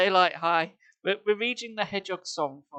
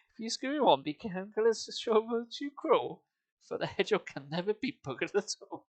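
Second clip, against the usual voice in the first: sing-song rhythmic verse reading with a heavy beat; poem recitation; 3 semitones higher than usual. Acted. Streamer reads a bawdy song to chat as rhythmic verse. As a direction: recite the verse with a bouncing meter, fighting back giggles.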